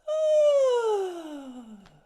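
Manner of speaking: very high, loud sliding sigh falling away; high-pitched sigh. Shown as a demonstration. Instructor demonstrating a very high pitched sigh for the class. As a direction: squeal the sigh out from the top and let it drop. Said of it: "a very high pitched sigh".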